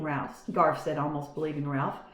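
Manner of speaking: plain reading voice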